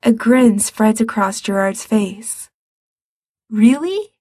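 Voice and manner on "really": "Really?" high with a rising question; surprised question. Acted. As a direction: ask "Really?" in hopeful disbelief. Asking in disbelief.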